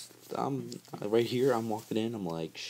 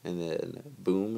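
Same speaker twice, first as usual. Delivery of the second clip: usual speaking voice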